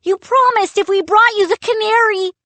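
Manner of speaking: high, loud, fast protest; outraged protest. A narrator's reading. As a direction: pour it out in a rush. Boy protests loudly.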